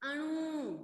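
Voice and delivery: "अणु" with final u drawn out; long vowel. Shown as a demonstration. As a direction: hold the end of "अणु" long. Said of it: "लंबा हो रहा है ना".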